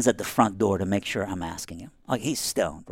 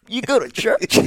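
high, loud disbelief; surprised question; 12 semitones higher, 5 dB louder than usual. Retold or imitated. Asking his weed-smoking buddy, high incredulous. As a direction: jump up in pitch, can't believe it. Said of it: "I'm like".

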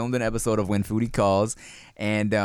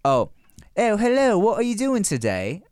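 high, polite put-on British accent; 7 semitones higher than usual. Shown as a demonstration. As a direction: greet someone in a prim mock-British voice. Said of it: "A Boston British accent".

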